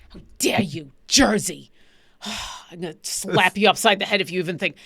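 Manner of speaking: playfully scandalized threat; mock outrage. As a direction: pretend to be deeply offended, with a sigh. Mock threat over Jersey accent.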